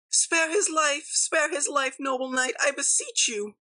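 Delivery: very high shrieking plea; shrieked cry. A narrator's reading. Lady begs Launcelot for her husband's life, shrieked plea. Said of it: "she shrieked and cried".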